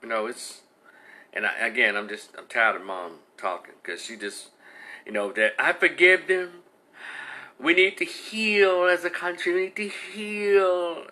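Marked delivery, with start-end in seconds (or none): 5.6-11.1 slow, sugary mock-pious plea; mock saintly slow voice; mocking the mother. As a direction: parody a sanctimonious mother begging for healing. Mocking the mother's public statement.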